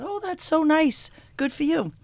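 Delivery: high, slow, sugary reassurance; lightly voiced chirpy small talk; mock sweet reply. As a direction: play the oblivious polite hairdresser. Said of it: "and I said".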